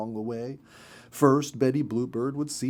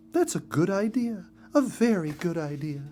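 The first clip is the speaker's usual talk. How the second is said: lightly voiced mother bird, higher; a pleased, higher bird-wife voice; Missus Bluebird voice; 7 semitones higher than usual. A narrator's reading. Mother bird agreeing with her husband, higher.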